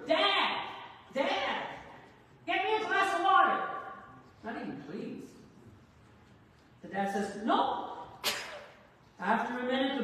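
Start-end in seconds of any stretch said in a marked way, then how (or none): 0.1-3.6 put-on high, loud calling-out as a boy; imitated child's scream. Boy in bed hollering to his father for water, imitated scream. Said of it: "screams come from the next room". 7.4-8.0 higher-pitched fatherly no; lightly acted firm refusal; mock stern refusal. Dad answering his son at bedtime, mock stern.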